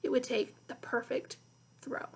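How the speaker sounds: plain reading voice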